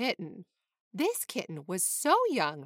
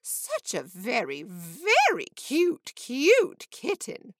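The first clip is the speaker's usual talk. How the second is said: sing-song; 2 semitones higher, 5 dB louder, slower than usual. A narrator's reading. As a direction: sing it out to the kitten, rising and stretched. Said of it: "said in a sing-song voice".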